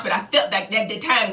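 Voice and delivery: ordinary delivery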